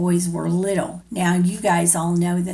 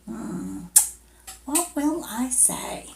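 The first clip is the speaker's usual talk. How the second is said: thinking aloud; 4 semitones higher, 8 dB quieter than usual. Acted. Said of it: "She's thinking".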